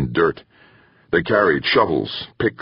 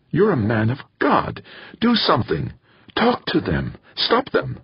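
high-pitched, urgent appeal; urgent plea; 9 semitones higher than usual. A narrator's reading. Woman begging a preacher to stop the mob, urgent. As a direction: plead with him to act, pitched high. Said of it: "her weariness suddenly forgotten".

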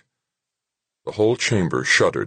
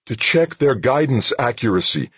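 raised pitch, as if shaken; shaken, higher-pitched reply; shuddering breath; 8 semitones higher, 4 dB louder than usual. A narrator's reading. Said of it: "his breath shuddering".

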